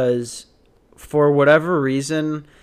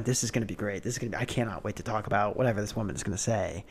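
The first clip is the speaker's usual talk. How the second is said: soft, fast gleeful muttering; quietly giddy inner thought; hushed gleeful aside; 5 semitones lower, 12 dB quieter, much faster than usual. Retold or imitated.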